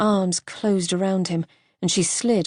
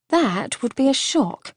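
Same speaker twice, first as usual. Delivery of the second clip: teasing; 6 semitones higher than usual. Acted. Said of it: "she teased".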